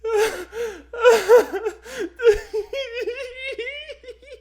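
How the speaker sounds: high wheezing laugh; helpless laughter. Real, not acted. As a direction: laugh till you cry.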